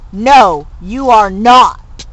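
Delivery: loud, slow mock-stern no; firm denial. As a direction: lay down the law slowly. Character firmly denies.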